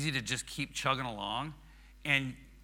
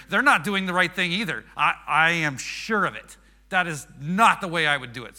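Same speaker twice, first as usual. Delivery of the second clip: loud, raised-pitch indignant accusation; mock outrage at others; judgmental finger-pointing; 4 semitones higher, 7 dB louder than usual. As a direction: act out self-righteous tattling. Acting out judging other people's conduct.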